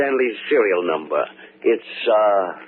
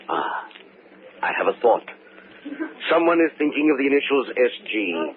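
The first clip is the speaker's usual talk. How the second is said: usual speaking voice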